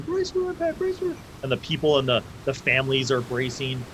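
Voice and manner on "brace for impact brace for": high, strained yell; far-off crew shouting; crewmen yelling. Acted. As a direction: shout the warning as you run below. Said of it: "you hear them yelling".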